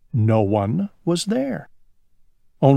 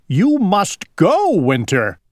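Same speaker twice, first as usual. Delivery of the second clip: shouting; 6 semitones higher than usual. Acted. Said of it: "shouted Owl".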